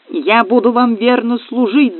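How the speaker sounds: high, slow, pleading pledge; gnome's high-pitched cry of devotion. A narrator's reading. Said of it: "закричал гном".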